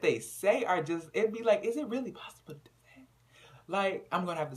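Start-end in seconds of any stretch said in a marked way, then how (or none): 1.6-3.1 breathy disbelieving whisper; hushed aside. Retold or imitated. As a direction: whisper it in awe. Speaker's own reaction, whispered.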